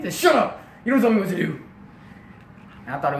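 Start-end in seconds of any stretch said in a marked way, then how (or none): 0.2-1.6 loud, higher-pitched telling-off; angry dad. Retold or imitated. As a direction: shut the child down hard. Acted dad snapping at son.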